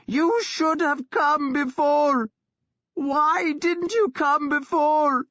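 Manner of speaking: furious scream. A narrator's reading. Sister screaming at her brother for leaving her, furious. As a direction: hurl it at him, wild with grief. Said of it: "Thyra screamed at him".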